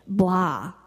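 low, deflated blah; drained blah. Retold or imitated. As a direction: let it drop like you've given up. Voicing her drained, empty feeling.

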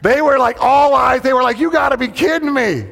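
high, loud, strained disbelief; shocked gasping voice. Retold or imitated. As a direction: keep the gasp going through the words. Talking on in-breath as shocked students.

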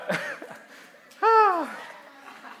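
high loud laugh breaking out; embarrassed laugh. Real, not acted. Speaker laughs at his embarrassing story, high loud laugh. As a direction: laugh at your own mortifying story.

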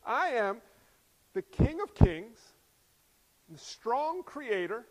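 slow, loud proclamation; emphatic divine self-announcement; God proclaiming titles. Acted. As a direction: declare each title with majesty and space. Voices God proclaiming his titles.

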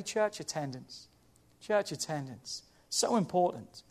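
soft, low repeated heading; quiet, low and deliberate; hushed announcement. Real, not acted. Preacher announces next point quietly.

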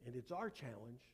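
measured sermon climax in short, weighted phrases; solemn preaching. Real, not acted. Preacher bringing congregation from cosmic dark to Christmas, solemn and deliberate.

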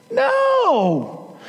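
sharp, high, emphatic no. Real, not acted. Preacher shouting down an excuse, emphatic. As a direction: cut the excuse off hard.

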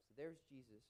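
ordinary delivery

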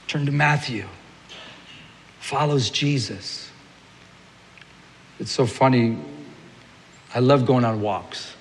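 ordinary talk

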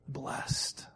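breathy whispered last word. Acted. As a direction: drop to a whisper on the final word. Whispered end of God's promise.

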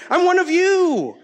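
high, loud, joyful declaration; playful elated cry; elated belonging. Retold or imitated. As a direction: proclaim it with giddy pride. Acted joy at becoming citizen.

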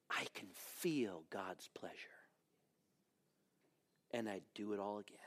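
soft, low, near-whispered confession; hushed testimony. Retold or imitated. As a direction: say it hushed, with quiet conviction. Quoted man's simple, heartfelt answer.

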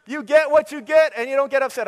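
put-on high, loud sing-song child voice; TV-show catchphrase. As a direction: chant it like a kid's show. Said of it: "some T V show that says that".

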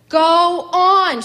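loud, high furious yell. A narrator's reading. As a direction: yell it out across the pasture. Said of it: "she yelled".

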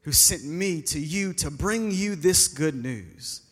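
slow, low, weighty angel voice, lightly voiced; solemn angel Gabriel. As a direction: announce it like a heavenly messenger, unhurried. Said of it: "And then the angel said".

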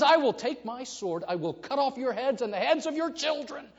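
enraged captain roaring threats; raging captain's threat. Retold or imitated. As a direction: threaten the rowers at full voice. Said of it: "And he says".

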